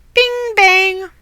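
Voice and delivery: high playful crash noises; bing bang sound effect. Acted. Bed crashes over stairs, reader voices the bangs high. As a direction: chime the crashes like a cartoon.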